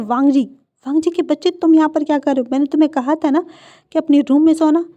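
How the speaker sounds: a mother bellowing a wake-up scolding; shouting mother. Acted. Mother yells at her son for sleeping elsewhere, loud scolding. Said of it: "वो ज़ोर से चिल्लाते हैं".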